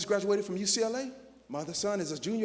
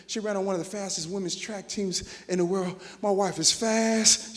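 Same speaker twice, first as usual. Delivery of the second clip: normal talking voice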